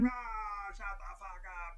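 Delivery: mock crowd yell in a whisper; distant-sounding whispered heckle. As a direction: shout it with no voice at all. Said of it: "like, yelling at us".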